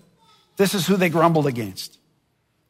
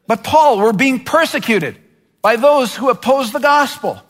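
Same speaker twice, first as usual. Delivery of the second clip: whiny complaint; 5 semitones higher than usual. Pretend. Church member complaining to Paul, higher and whining. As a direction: plead with Paul like an aggrieved believer. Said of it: "we could say, and Paul likely heard".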